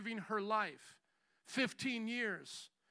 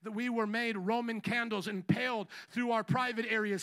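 normal talking voice

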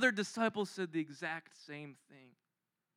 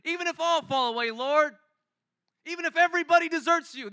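higher and louder, fast at the end; fervent protest; 9 semitones higher, 9 dB louder than usual. Retold or imitated. Peter insisting to Jesus, loud and fervent. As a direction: cry out your loyalty with full conviction.

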